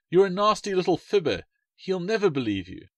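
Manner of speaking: put-on high, scolding child voice; indignant girl. A narrator's reading. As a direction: tell him off like an angry sister. Alice scolds Wally.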